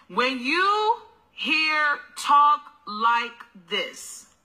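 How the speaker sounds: slow, drawn-out preacher's address; drawn-out sermon address. Acted. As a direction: call out to the women like a preacher, slowly. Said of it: "I'm a different kind of preacher".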